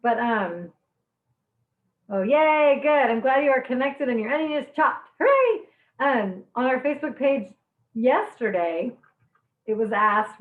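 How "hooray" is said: a much higher, louder hooray; cheer. Real, not acted. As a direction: cheer them on. Students connected, onions chopped.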